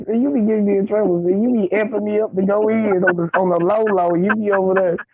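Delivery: ordinary talk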